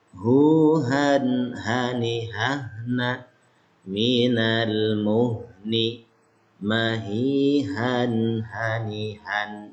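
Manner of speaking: Minal muhni drawn out very slowly, ha pronounced from the throat; slow Arabic recitation. Shown as a demonstration. (bacaan lambat dengan ha dari tenggorokan)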